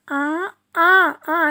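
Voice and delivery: long nasal आ sung through the nose; nasal aa demo. Shown as a demonstration. Teacher showing children the nasal vowel. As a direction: let आ resonate through the nose. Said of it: "नासिका से बोला जाता है इसको".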